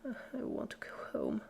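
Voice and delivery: breathless, sobbing plea; sobbing whisper. A narrator's reading. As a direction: beg through tears, barely voiced. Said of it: "Dash sobbed".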